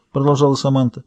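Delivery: ordinary delivery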